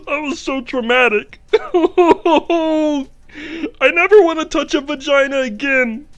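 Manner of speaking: high, loud, traumatized impression; Jordan Peterson. Comedian's psychologist laments after sex, Jordan Peterson impression. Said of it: "This is what Jordan Peterson sounds like".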